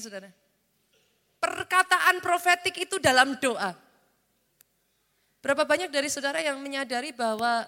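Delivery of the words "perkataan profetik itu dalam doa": loud, high and slow preaching; raised preacher's voice, drawn out; emphatic proclamation. Real, not acted. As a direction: proclaim it slowly to the whole congregation. Preacher proclaims key teaching point.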